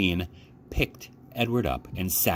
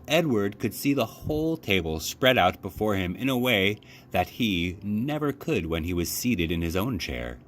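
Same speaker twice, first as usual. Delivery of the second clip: usual speaking voice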